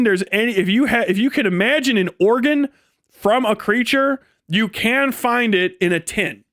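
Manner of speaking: usual speaking voice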